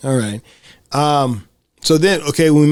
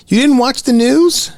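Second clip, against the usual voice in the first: put-on pitched up, surprised child; high kid voice; 11 semitones higher, 3 dB louder than usual. Girl questioning her friend, high and surprised. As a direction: ask in disbelief like a little girl. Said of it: "And Jancy says".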